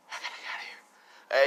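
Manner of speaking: forceful stage whisper; urgent whisper. Acted. Son fleeing as cops arrive, urgent whisper. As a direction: whisper it hard to yourself. Son repeats need to flee.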